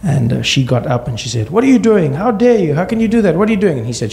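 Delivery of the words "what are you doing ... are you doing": angry mom. Retold or imitated. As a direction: shout back at him, pitched high. Mom defies husband.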